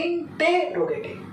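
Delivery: interrogative drawn out slowly, in-TE-rogative; syllable-by-syllable pronunciation. Shown as a demonstration. Teacher explaining the spelling, interrogative slowly pronounced in-TE-rogative. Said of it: "इंटे के ई कपे करके तो T ए टे".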